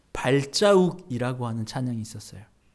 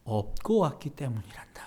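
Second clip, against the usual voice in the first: whispered tender answer; 3 semitones lower, 7 dB quieter than usual. Retold or imitated. Jesus whispering comfort to the believer. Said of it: "예수님께서 이렇게 얘기하셨다는 거죠".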